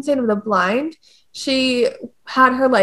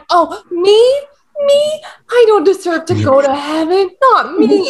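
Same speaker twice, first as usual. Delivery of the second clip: high, loud, hammed-up humble protest; mock false modesty; 7 semitones higher, 4 dB louder than usual. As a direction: play Dante fishing for praise. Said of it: "Dante's like".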